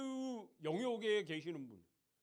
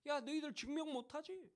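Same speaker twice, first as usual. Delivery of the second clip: mocking taunt; 5 semitones higher, 5 dB quieter than usual. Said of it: "이렇게 조롱해요".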